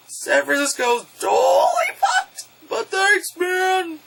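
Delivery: high, straining comic yell; hammed-up high-pitched complaint turning to thanks; mock farewell shout. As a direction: call it out after a departing hero. Mock call after departing Godzilla.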